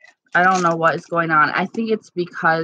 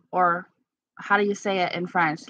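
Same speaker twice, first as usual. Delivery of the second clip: usual speaking voice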